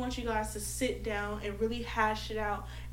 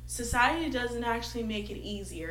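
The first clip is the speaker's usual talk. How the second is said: ordinary talk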